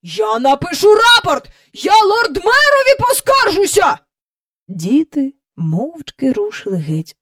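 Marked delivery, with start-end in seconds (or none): 0.0-4.0 high, loud indignant tirade; outraged official, pitched up and loud; shouting threats. A narrator's reading. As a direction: yell threats of complaint at the sky. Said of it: "викрикував свої погрози в небо".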